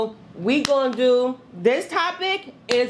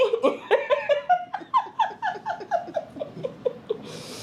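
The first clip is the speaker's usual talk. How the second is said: high, long laughing fit; real helpless laughter; big cackle; 5 dB quieter than usual. Real, not acted. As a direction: burst out laughing and let it tail off. Laughs at her own line.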